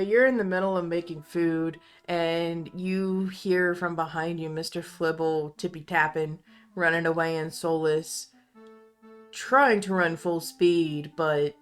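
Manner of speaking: normal talking voice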